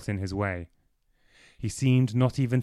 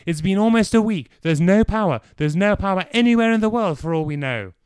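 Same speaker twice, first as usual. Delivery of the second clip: angry outburst; 9 semitones higher, 7 dB louder than usual. Acted. Clive's outburst continues.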